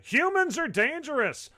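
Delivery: high, emphatic recital; dramatic post reading. Acted. Host reading a listener's alarming post, mock-dramatic delivery. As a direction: read it out like a grave public warning.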